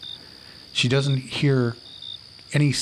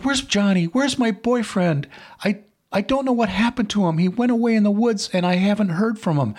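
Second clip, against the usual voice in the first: put-on frantic girlfriend; 9 semitones higher than usual. Frantic girl asks about boyfriend.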